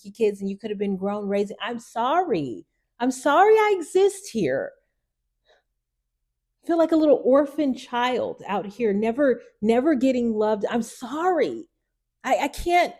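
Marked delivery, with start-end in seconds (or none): 1.6-12.9 pleading, holding back a real cry; apologizing to her mother, close to tears; on the verge of tears. Real, not acted. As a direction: voice rising high and cracking as tears come. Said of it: "I'm about to cry".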